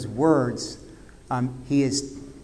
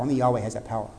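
"Only Yahweh has that power" dropped lower and softer; a low, soft echo of "Only Yahweh has that power"; hushed repeat; 6 semitones lower, 7 dB quieter, much faster than usual. Shown as a demonstration. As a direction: let the name sink in, hushed. Hushed repeat of key line.

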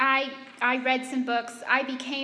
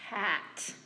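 ordinary talk, nothing marked

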